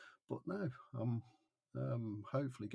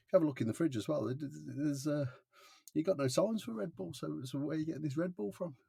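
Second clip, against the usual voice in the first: loud, higher, indignant challenge at first fast; heated retort; 7 semitones higher, 7 dB louder than usual. Retold or imitated. Accused of stealing, arguing loudly with the security bloke. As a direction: push back hard like you're wrongly accused.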